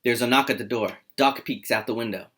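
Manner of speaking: ordinary talk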